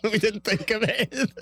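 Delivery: high laugh spilling into the words; laughing admission. Real, not acted. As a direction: laugh helplessly while owning up. Laughs admitting they had no plan.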